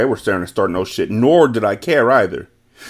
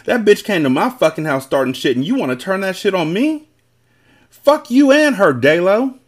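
higher, heated retort building to a louder curse; angry yell; 7 semitones higher, 4 dB louder than usual. A narrator's reading. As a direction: lash back, rising to a high shout. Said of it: "Annalise yelled angrily".

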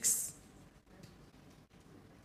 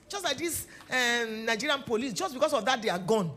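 ordinary delivery

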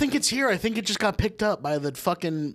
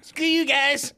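Cartman voice; 10 semitones higher, slower than usual. Pretend. Cartman catchphrase bit.

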